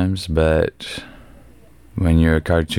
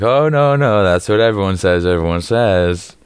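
mocking parody; 3 semitones higher, 3 dB louder than usual. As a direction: echo them back in mockery. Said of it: "And I'm like".

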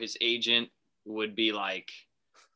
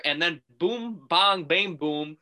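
mock boom sound effect; 5 semitones higher, much slower than usual. Deal done sound effects.

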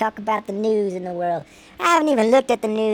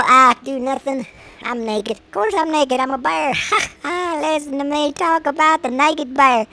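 ordinary talk, nothing marked

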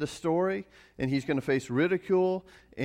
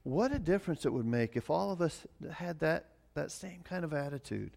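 ordinary talk, nothing marked

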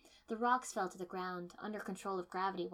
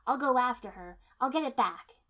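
angry; 5 semitones higher, 11 dB louder than usual. Acted. Furious vow to pursue.

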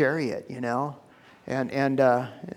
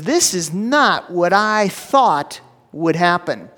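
slow, louder, offended; louder indignant complaint; 3 semitones higher, 6 dB louder, slower than usual. Acted. Naaman complains about the prophet, openly offended and louder. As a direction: lean into the outrage, slowly and louder. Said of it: "He says".

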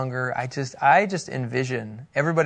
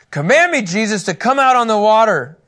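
higher, louder demanding plea; mock Peter; 7 semitones higher, 7 dB louder than usual. Preacher replays Peter asking to walk on water, hammed-up. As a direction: ham up Peter's bold request. Peter's request again, mocked.

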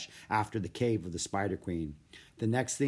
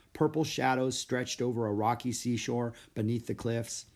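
ordinary delivery, nothing marked

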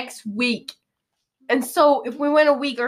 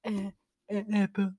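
put-on dopey kid voice; 2 semitones lower, 8 dB quieter, faster than usual. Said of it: "He's like".

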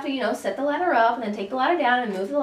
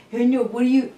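mock Neil voice; 4 dB louder than usual. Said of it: "that's your voice that you use whenever you act like you're me".

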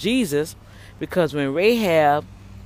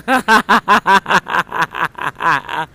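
disbelieving laugh; 6 dB louder than usual. Real, not acted.

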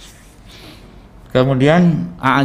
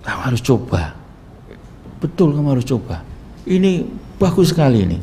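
higher-pitched voice of a pill-pushing friend; menirukan teman membujuk; 5 semitones higher than usual. Retold or imitated. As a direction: imitate a pal urging you to try it, pitch climbing. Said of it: "Terus dia ngasih saya". (suara teman yang membujuk supaya mencoba)

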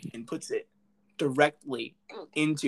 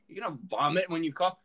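ordinary delivery

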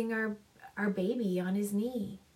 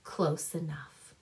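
lower, softer knowing repeat; knowing wink; 5 semitones lower, 4 dB quieter than usual. A narrator's reading. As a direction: say it with a sly wink. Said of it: "Fanny winked".

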